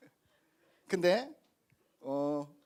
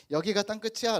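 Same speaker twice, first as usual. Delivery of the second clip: mock declaration; 5 semitones higher, 6 dB louder than usual.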